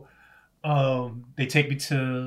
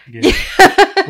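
high, loud and slow, drawn out; mock big realization; 17 dB louder, much slower than usual. Real, not acted. To his co-host about the drink metaphor, a hammed-up loud slow realization. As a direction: stretch it like a hammed-up lightbulb moment.

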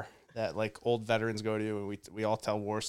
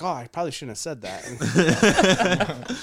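sheepish laughing quote; 4 semitones higher, 8 dB louder, slower than usual. Retold or imitated. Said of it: "he's like".

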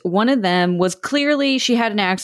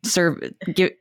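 ordinary delivery

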